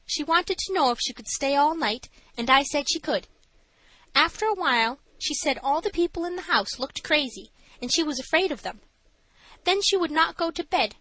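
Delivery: high-pitched matron's testimony; high character voice answering the judge; nervous matron. A narrator's reading. Voices Missus Stannard.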